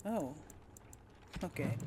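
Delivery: ordinary delivery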